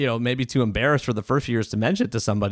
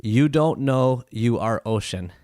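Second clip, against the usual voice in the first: very slow, careful; slower than usual. Retold or imitated. Doctor in Japan advising a worried foreign patient, very slowly and carefully. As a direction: imitate slow, halting broken English. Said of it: "very, very slowly, very, very carefully".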